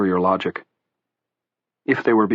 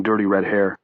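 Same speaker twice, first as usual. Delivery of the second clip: ordinary delivery, nothing marked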